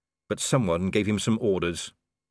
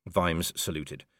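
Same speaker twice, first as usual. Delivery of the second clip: ordinary delivery, nothing marked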